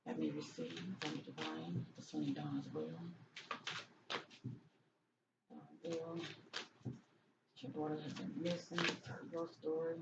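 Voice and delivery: soft, quiet prayerful channeling voice; hushed invocation. Acted. Reader calling on her angel before the cards, in a hushed prayer voice. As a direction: murmur it like calling on spirit guides. Invoking spirits before reading.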